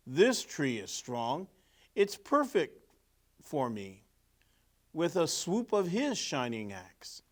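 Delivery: lively storybook narration; reading to children. A narrator's reading. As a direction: read it like a picture book to kids. Reading picture book aloud.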